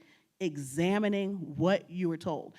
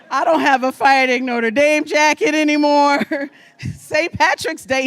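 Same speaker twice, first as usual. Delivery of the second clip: laughing loudly through the words, pitched up; 7 semitones higher, 13 dB louder than usual. Real, not acted. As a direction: laugh out the loss of the jacket. Mock-mourning her lost Irish identity.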